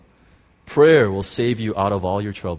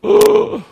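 high, loud gasp of shock; sharp gasp; 9 dB louder than usual. Retold or imitated. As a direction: suck in air in sudden awe. After the realisation, awe.

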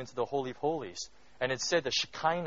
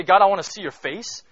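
loud, raised plea to God; loud, higher fervent prayer; bold cry; 4 semitones higher, 9 dB louder than usual. Retold or imitated. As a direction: throw it out as a daring cry. Preacher dramatizes the bold prayer.

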